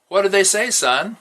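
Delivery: a lightly voiced judge asking a boy; judge's question. A narrator's reading. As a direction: ask the boy kindly what they said.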